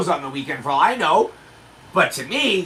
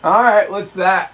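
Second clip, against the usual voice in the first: slow, louder, lightly acted suspicion; suspicious old man; 5 dB louder than usual. Homeowner spotting a strange car, slow suspicious voice. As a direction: draw it out as if sizing up an intruder.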